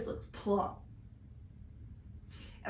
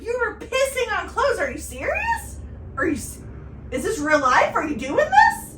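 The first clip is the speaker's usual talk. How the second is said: high-pitched, incredulous scolding; mad disbelief; 10 semitones higher, 6 dB louder than usual. Retold or imitated. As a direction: demand an answer, outraged and half-laughing. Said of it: "I was so mad".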